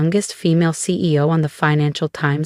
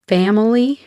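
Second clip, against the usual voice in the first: incredulous; 5 semitones higher than usual. Acted. Incredulous echo of mother's word.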